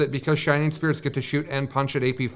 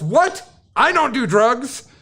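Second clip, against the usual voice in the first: much higher, slow, outraged bear; offended bear; 8 semitones higher, 4 dB louder, much slower than usual. Retold or imitated. Bear denying drugs to the bartender, high and slow. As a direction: squeal the denial like a wounded bear. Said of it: "And the bear says".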